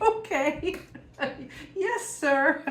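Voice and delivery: high, giggly yes-sir reply; laughing mock obedience; mock obedient. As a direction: play the meek good girl, laughing. Obeying the man's order, laughing.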